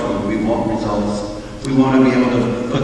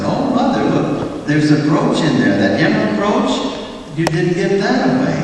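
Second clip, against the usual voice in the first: eager daughter; 6 semitones higher than usual. Retold or imitated. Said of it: "And one daughter looks in".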